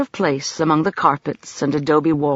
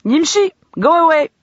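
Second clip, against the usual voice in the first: higher, louder curt dismissal; curt command; 12 semitones higher, 6 dB louder than usual. A narrator's reading. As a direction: bark him out the door. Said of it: "He said curtly".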